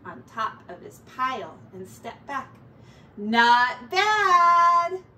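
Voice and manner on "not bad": loud, high admiring sing-song; Ratty admiring his junk heap; pleased sing-song. Acted. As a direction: croon it proudly at the pile.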